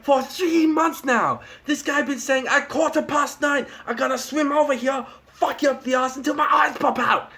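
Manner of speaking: put-on high, broken-English accent; hammed-up Japanese accent; mock Japanese accent. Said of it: "he's like".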